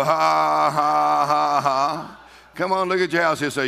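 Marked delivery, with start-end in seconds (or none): 0.0-2.2 loud, sustained, deliberate "ha ha ha"; defiant mock laugh. Shown as a demonstration. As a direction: answer the devil with a put-on laugh. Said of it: "You go".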